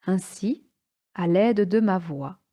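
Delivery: ordinary talk, nothing marked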